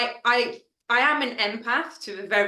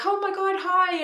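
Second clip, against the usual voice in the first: squealed, much higher hello; excited greeting; 9 semitones higher than usual. Retold or imitated. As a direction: gush like you're spotting an old friend. Friends meeting in person, hugging.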